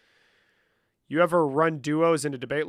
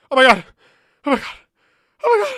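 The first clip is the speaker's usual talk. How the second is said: loud, high "Oh my God"; loud shocked outburst; shocked shout; 9 semitones higher, 11 dB louder, much faster than usual. Real, not acted. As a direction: burst out in disbelief. Frantic exclamations at download.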